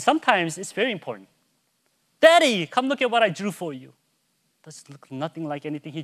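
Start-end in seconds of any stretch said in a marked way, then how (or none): 2.2-3.9 lightly voiced kid calling a parent; loud, higher call, then easing into the request; imitated eager child. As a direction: imitate a son showing off a drawing. Voices son calling him to look.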